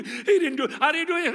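mock kid protest through a laugh; a touch of a giggly little boy; childish laughing denial.

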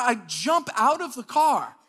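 ordinary talk, nothing marked

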